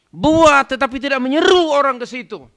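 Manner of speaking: high, loud declamation; full-force preaching. Real, not acted. Preacher listing who abandons the Quran, full-force preaching. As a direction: pitch it up and proclaim every word.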